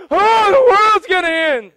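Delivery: over-the-top mock panic; mock panicked world. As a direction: mimic the world losing its mind over doom. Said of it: "You know what they do?".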